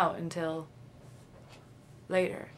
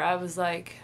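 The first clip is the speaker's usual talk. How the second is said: ordinary delivery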